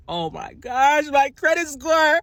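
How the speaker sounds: high, loud mock wail over a credit score; squealing mock dismay; mock panic. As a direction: ham up a panicked victim. Mocking panicked people.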